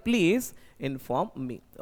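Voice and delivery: very slow, each word of "Please inform me" drawn out; slow correct version. Shown as a demonstration. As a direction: dictate the right answer slowly, word by word. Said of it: "क्या बोलना पड़ेगा?".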